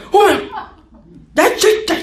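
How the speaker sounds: mock startled cry, pitched very high; startled outburst. Person startled by something darting past, exaggerated angry shriek. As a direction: jump in fright and snap into annoyance. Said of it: "그럼 우리는 뭐예요?".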